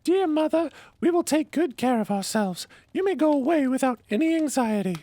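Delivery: put-on a little kid reassuring its mother, very high; little goat kid voice. A narrator's reading. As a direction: squeak it out like a small goat child. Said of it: "The kid said".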